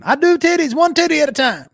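put-on high, loud Southern doctor accent; hammed-up Southern accent. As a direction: pitch it like a Southern huckster doctor. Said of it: "that doctor with a Southern accent".

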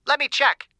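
thin, high voice through an earpiece; small tinny voice over comms; tinny radio voice. A narrator's reading. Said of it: "the voice said".

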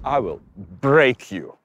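barbarian. Pretend. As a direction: growl it like a movie villain. Repeated threat, much deeper.

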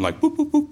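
high, playful boop noises; tap-tap with your voice; mock boops. Imitating Yabichay tapping.

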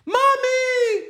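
very high, loud squealed "Mommy"; mock scream. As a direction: imitate a panicked wrestler shrieking for mommy. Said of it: "while he screams".

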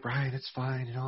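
soft calming grandma voice; soothing grandma. Retold or imitated. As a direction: hush him gently like a grandma. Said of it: "My grandma would be like".

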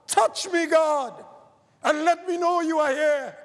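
high, loud plea, reaching upward; pleading cry to God. Acted. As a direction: call out to God like a desperate man. Man in story pleading to God.

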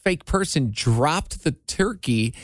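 ordinary talk

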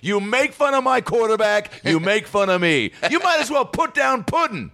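high, squeaky mock outrage. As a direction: shriek out a fake-hurt complaint. Mock rant at Rodgers.

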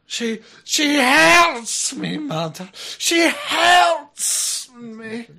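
high, slow mock lament; melodramatic wail. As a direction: wail it like a tragic ghost story. Melodramatic wail after song line.

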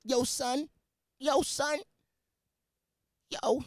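high-pitched mocking New York rapper imitation; hammed-up wannabe rapper voice; mock fake-deep New Yorker. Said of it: "that's how you guys sound".

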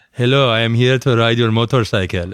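smooth, mock-suave Iranian accent. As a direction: show how smooth the Iranian accent sounds. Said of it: "the Iranian accent's too, like, suave".

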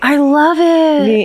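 drawn out, high delight; slow delighted gush. Real, not acted. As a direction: stretch it out, thrilled. Delighted reaction to story.

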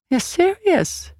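high, slow disbelief; marveling. A narrator's reading. Swede reacting to Grace offering her life, marveling. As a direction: draw it out in theatrical wonder. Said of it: "the Swede marveled".

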